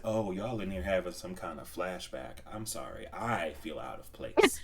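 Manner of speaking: muttered low and soft; quoted aside. Retold or imitated. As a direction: say it quietly, a little embarrassed. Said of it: "I said".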